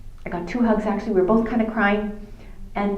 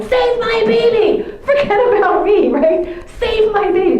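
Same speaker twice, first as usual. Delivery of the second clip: much higher, louder, slow pleading cry; desperate mother; 12 semitones higher, 7 dB louder, slower than usual. Retold or imitated. Dying mother begging the doctor for her baby, desperate cry. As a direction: beg for your baby's life.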